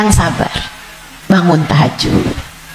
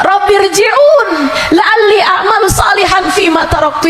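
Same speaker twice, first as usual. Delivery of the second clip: begging soul; 11 semitones higher than usual. Retold or imitated. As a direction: beg God for one more life. Dead soul begging God. (ruh memohon kepada Allah, berteriak memelas)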